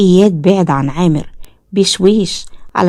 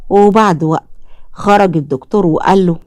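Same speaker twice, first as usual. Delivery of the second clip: plain reading voice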